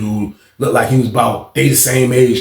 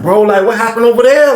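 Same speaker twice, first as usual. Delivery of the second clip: nosy; 8 semitones higher, 4 dB louder than usual. Retold or imitated. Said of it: "I'm getting super nosy".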